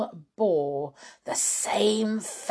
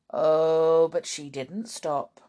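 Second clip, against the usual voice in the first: ordinary delivery, nothing marked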